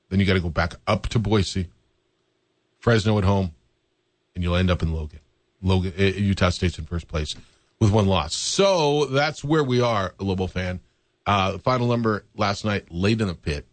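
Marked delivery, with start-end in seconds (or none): none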